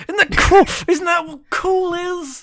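high, gushing fanboy voice, rising to a laugh; mock gushing. Mocking the comic's creators to his co-host, gushing high voice. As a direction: gush like a dumb fan of the idea.

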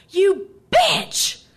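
high, loud angry yell; screamed insult. Retold or imitated. Angry driver adding an insult, shouting. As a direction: spit the insult out. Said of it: "Then she added".

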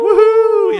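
very high, very loud "woohoo"; wooing. Retold or imitated. Imitating the group cheering the stripper, full-out whoop. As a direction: let rip a piercing crowd cheer. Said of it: "we, like, wooed".

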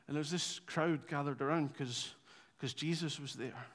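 low-key reminiscing. Acted. Disciples at dinner recalling Jesus, low-key and soft. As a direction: chat it over lazily like old friends at dinner. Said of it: "pretty chilled, pretty low-key".

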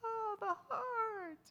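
high, slow, swooning sigh; mock romantic gush; mock lovesick swoon. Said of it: "we're like".

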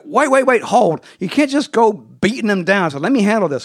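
mock urgent Orion halting the fight; urgent stop. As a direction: jump in to stop a beating. Orion stopping Batman, retold.

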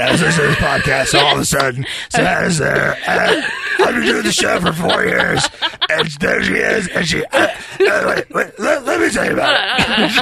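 mock Brandon barging in. Guest barging in to steal the microphone, in a hammed-up Brandon voice. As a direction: crash the podcast as the pushy friend. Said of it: "Brandon, get outta here".

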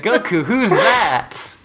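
hammed-up question through a laugh; acted clueless question. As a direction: play up the puzzlement, slowly. Acted reply in imagined anime tract.

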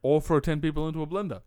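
ordinary talk

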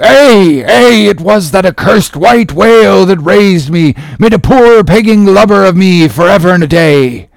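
high, loud, heartstricken cry; anguished shout. Acted. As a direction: cry it out like a wounded animal. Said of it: "he shouted with a terrific, loud animal sob".

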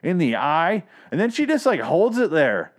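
high-pitched, indignant disbelief; appalled yelling. Real, not acted. Podcaster reliving a gruesome eye stabbing, appalled yelling. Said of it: "Sorry for yelling".

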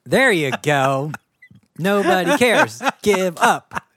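mock defeatist. Mock defeatist, loud high.

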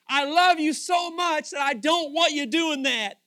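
high, loud, insistent pleading; impassioned loving rebuke. Acted. God corrects the churches he walks amongst, impassioned rebuke. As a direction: plead at full voice out of love.

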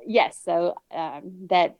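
ordinary delivery

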